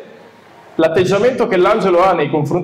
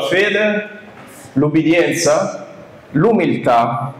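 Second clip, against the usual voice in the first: slow listing; much slower than usual. Shown as a demonstration.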